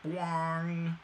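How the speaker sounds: high springy cartoon boing; boing sound effect. Shown as a demonstration. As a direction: make the metal pop out like a spring. Helmet popping out sound.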